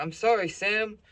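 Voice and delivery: high, loud imitation of a pleading dad; apologetic dad. Dad mistakenly calls the cop Sam, frantically apologetic. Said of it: "He's talking about".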